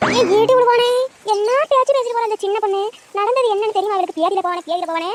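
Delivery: the aunt screams back at the woman who insulted her; angry shouting. Acted.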